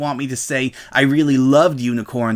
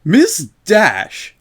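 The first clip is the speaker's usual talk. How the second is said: higher, louder, biting name; spat out; 8 semitones higher, 5 dB louder than usual. A narrator's reading. Shop owner to Rainbow, spitting the name angrily. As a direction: spit the name out in fury. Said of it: "the shop owner spat out".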